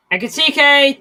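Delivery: sudden high excited call of the name; excited shout. Real, not acted. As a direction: yelp the name as he breaks through. Chance on goal while watching.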